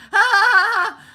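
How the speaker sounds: high, put-on Scottish cackle; loud, hammed-up string of ha-has; Scottish laugh. Shown as a demonstration. Showing chat how Scots laugh, exaggerated high laugh. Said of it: "that's how you laugh in Scottish".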